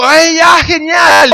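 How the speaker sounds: an eager guest accepting a barbecue invite; excited reply. Retold or imitated. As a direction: jump at the invitation. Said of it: "Y yo digo".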